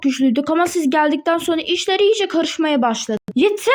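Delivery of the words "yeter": short yell, a touch higher. Acted. Big Macintosh yelling at the others to stop. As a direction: snap it out to end the talk. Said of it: "Big Macintosh ne biçim, ne biçim bağırdı ya?".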